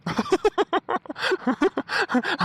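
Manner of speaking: a long, delighted laugh; amused laugh. Acted. Husband laughing at his wife's sweaty-eyes excuse, amused laugh. Husband mocks her fake denial.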